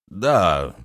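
a touch lost, unsure of oneself; flustered mumbling. A narrator's reading. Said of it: "растерянно забормотал Рувен". (растерянно, неуверенно)

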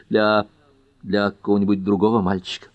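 ordinary delivery, nothing marked

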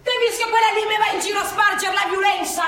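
high, loud indignant complaint; scandalised protest; indignant outrage. Acted. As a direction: call out the troublemaker.

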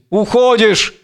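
acted fury. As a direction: hurl reproaches after her, rage held in check. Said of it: "полон ярости". (сдержанно сыгранная ярость, повыше)

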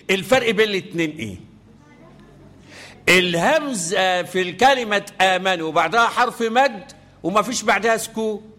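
ordinary talk, nothing marked

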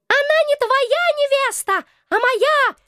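high squeaky rat king, smug; twitchy squeaking rat voice. Acted. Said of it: "Самоуверенный голос главного крысиного короля".

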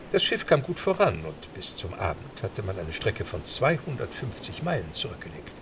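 ordinary delivery

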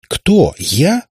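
squeaky high startled "Кто? Я?"; a girl caught out, leaping up in protest; indignant surprise. A narrator's reading. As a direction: jump up at the accusation, voice shooting up. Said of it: "подпрыгнула на кровати Катерина".

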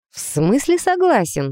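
displeased, baffled question; frowning question. A narrator's reading. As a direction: query it with a frown. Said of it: "нахмурилась я".

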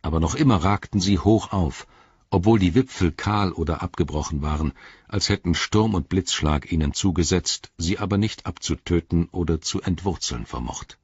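usual speaking voice

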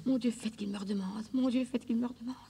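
whispered litany rising toward panic; desperate prayer. Acted. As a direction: beg God under her breath, rising in despair. Desperate prayer for him to call.

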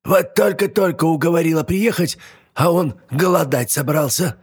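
put-on high exasperated old hag; old witch voice. Yaga complains.

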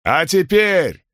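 high, slow ruler's vow; proclaiming khan. Acted. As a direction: declare it to thirty thousand. Khan addresses the crowd again.

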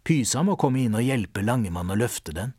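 plain reading voice

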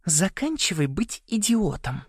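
high, slow, tiny squeak; little voice. A narrator's reading. Hidden Ариана scolds Саймон, tiny squeaky voice. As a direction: speak tiny and high into his ear. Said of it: "сказал голосок Саймону в ухо".

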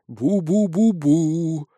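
very slow, wavering "boo-boo"; eerie mumbling nearby. A narrator's reading. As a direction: drone it like a spook close by. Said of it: "раздалось уже совсем близко". (протяжное призрачное бубнение)

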